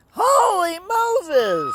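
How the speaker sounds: Holy Moses drawn out high and rising. Real, not acted. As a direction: stretch Holy Moses up in delight. Repeated higher, rising.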